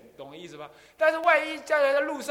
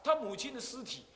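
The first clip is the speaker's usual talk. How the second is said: ordinary delivery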